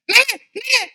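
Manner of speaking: high mock grandma scolding; grandma's voice. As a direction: imitate a granny saying don't cry. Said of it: "Cuba tengok opah dia". (suara opah yang tinggi)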